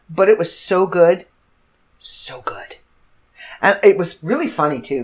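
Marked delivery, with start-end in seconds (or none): 2.0-2.8 soft unvoiced savoring whisper. Real, not acted. She whispers to viewers how good the burger was. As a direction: breathe it out in a whisper.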